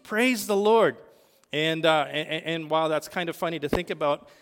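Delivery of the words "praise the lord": retold outburst. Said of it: "he couldn't help but speak".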